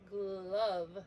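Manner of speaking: GLOVE with the GL stretched, firm and low; drawn-out opening sounds. Shown as a demonstration. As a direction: stretch the start of Glove slowly. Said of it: "When I say glove".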